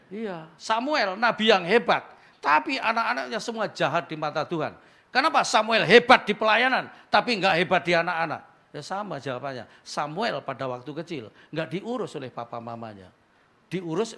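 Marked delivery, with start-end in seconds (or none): 0.1-8.4 loud, high preaching voice; preaching at full force. Acted. Pastor drives the point home to the congregation, loud and high.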